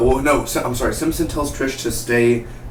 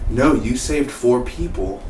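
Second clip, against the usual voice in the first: usual speaking voice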